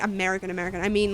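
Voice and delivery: normal talking voice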